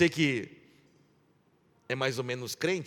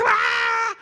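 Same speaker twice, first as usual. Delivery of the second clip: high shrieked "Ah!" much louder; imitated scream; 6 dB louder than usual. Pastor retelling Judite's cry, high loud mock scream. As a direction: mimic an old lady's sudden high scream. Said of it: "ela grita".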